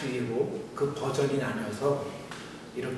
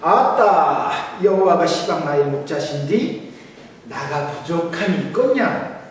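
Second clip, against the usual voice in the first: hearty Jeolla dialect, pitched up and louder; 6 semitones higher, 6 dB louder than usual. Pretend. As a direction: play a folksy Jeolla elder reciting the psalm. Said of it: "먼저 전라도 버전입니다". (신나게 과장한 전라도 사투리)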